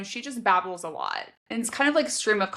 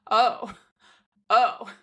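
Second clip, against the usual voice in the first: a breathy, louder little oh, oh; mock flustered gasp; 4 dB louder than usual. Reader reacting to a racy line, mock flustered. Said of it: "And I'm just like".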